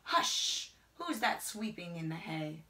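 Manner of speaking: ordinary delivery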